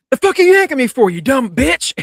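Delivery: loud, high furious shout; angry yell. Retold or imitated. Ghost girl yelling at the medium, loud and furious.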